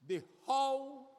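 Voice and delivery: preacher driving home "the how"; stress on HOW. Shown as a demonstration. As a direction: punch "how" hard. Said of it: "is emphasizing".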